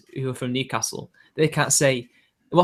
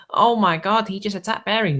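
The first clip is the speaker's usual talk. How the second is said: lightly voiced scandalised fan, pitched up; mock outraged fan; 6 semitones higher than usual. Co-host voices Bering's fans complaining, mock outraged fan. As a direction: imitate an aghast fan a little higher. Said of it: "Bering fans are just like".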